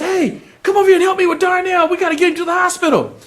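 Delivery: the dad calling neighbours to help Darnell; urgent call. Acted. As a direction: shout over to the neighbours fast.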